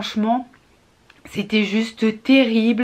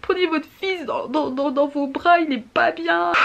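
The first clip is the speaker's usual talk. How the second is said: tearful, higher, pleading voice; crying pleading; 6 semitones higher than usual. Retold or imitated. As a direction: comfort her while choking up. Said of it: "J'étais en train de chialer chez Noz".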